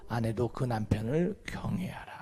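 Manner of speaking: hushed, breathy reading of the verse; whispered scripture. Real, not acted.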